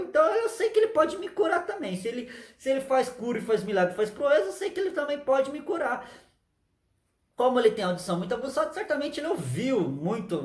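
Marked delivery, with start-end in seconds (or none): 0.0-6.1 hopeful blind man. Acted. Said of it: "então ele ficou".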